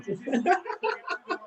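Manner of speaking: squealing giggle; high-pitched laugh. Real, not acted. Laughing at wrestler's reaction.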